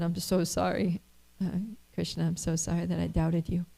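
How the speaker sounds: soft, hushed apology through mild tears; a quiet, sorry plea to Krishna; tearful apology. Retold or imitated. Husband weeps, apologizes to Krishna.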